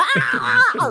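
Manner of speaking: a high, loud dying scream; death scream. Shown as a demonstration. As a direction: show how a real death cry goes. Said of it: "You supposed to".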